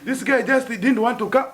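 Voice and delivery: lightly hammed rumour; mock gossip voice. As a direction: shrug it off like a gossip. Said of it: "Others even saying".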